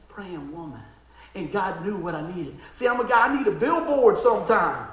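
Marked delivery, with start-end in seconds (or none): none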